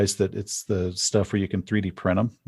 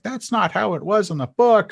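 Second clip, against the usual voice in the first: mock complaint; 10 semitones higher, 4 dB louder than usual. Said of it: "and then you're all like".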